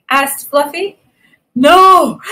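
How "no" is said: loud, high gasping cry; gasped. A narrator's reading. Rhinoceros rejecting Fluffy's guess, gasping loudly. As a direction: blurt it, then gasp for air. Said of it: "gasped the rhinoceros".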